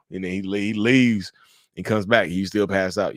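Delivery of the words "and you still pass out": normal talking voice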